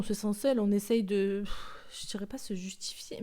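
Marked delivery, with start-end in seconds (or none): 1.9-3.2 unvoiced self-correction; whispered aside. Real, not acted. Podcaster hesitating over her own word choice, whispered. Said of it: "mais oui c'est ça".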